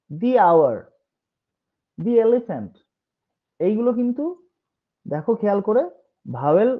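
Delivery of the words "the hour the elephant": "the" said as dee before "hour" and "elephant"; long ee vowel in "the"; the as thee. Shown as a demonstration. Teacher showing words starting with vowel sounds, "the" as dee. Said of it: "দি বলবো".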